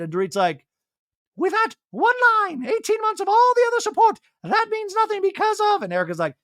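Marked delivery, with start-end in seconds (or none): 1.4-5.8 very high, loud, squeaky voice; Dorit impression. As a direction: squeak it like a posh socialite.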